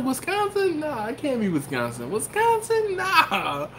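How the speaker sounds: squeaky falsetto incredulity; comically high-pitched retold disbelief; mock disbelief. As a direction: replay your doubt, shooting up very high. Replays his own disbelief.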